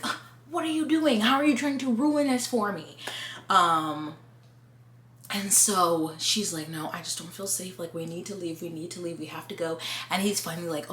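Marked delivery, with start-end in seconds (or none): 0.1-3.0 higher, whiny protest; hammed-up irritated husband; mock annoyed boyfriend. Jack voiced annoyed in recap. 6.7-9.8 softer, pleading to leave; anxious hushed voice; hushed urgent plea. Retold or imitated. Alice voiced scared in recap.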